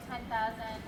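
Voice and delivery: usual speaking voice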